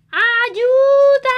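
very loud, high drawn-out yell; shouting for help. Acted. The family yells again for help, very loud and long. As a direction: scream help at the top of your voice. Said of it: "Y gritan".

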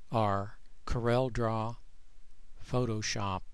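ordinary talk, nothing marked

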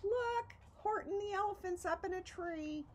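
high, gleeful mocking shout. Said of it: "they shouted with glee".

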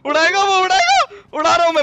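loud, high, excited yell; urgent warning shout. Real, not acted. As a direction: shout a frantic warning to the squad. Warns squad of bomb blast.

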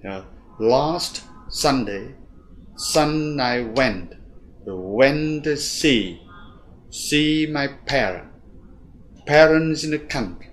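slow, evenly spaced stressed beats; even rhythm demo. Shown as a demonstration. Teacher showing steady English rhythm. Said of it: "Nếu chúng ta muốn giữ một cái nhịp đều đặn".

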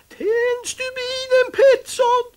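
very high, loud cat shriek; Findus screaming. Acted. Findus the cat screams for Pettersson to light the fuse. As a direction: shriek the order at the top of the voice. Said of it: "och skrek".

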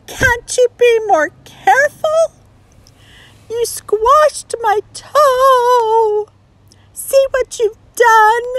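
high, loud, tearful complaint; whiny frog voice. Acted. Frog complaining to Sally Jane about his toe. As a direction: scold her like a hurt little frog.

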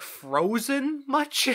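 high, slow, sarcastic drawl; mocking drawl. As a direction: stretch it out sarcastically. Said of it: "It might be frozen much".

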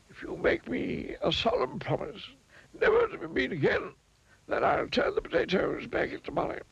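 high, partly hushed wizard; a high wizard laying down the terms. A narrator's reading. Wizard bargaining with the miser, high and hushed.

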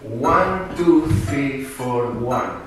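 stretched, measured counting that steps down in pitch; slow count. Shown as a demonstration. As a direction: count the beats out slowly. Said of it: "It's very slow".

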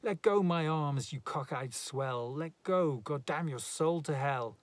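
furious cursing. A narrator's reading.

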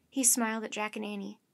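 ordinary delivery, nothing marked

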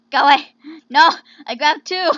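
loud, exasperated outburst; loud protest. Real, not acted. Player fending off mistakes in the game, shouting.

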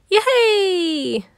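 high, gleeful squeal; bouncing child yelling yay; excited cheer. Acted. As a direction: cheer like a kid on a trampoline. Child cheers while jumping.

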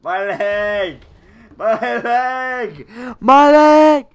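builds from pleading to screaming; mock wounded scream. Wounded player cries for help.

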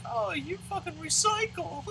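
very high, slow falsetto sneer; mock them in a drawn-out falsetto, ending in laughter; mocking imitation. Mocks pious recyclers.

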